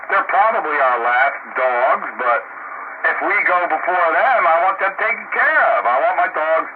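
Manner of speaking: ordinary talk, nothing marked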